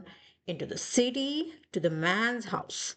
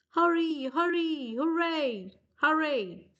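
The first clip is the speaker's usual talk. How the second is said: 1 eager firemen; 5 semitones higher than usual. Acted. Firemen rushing out excited.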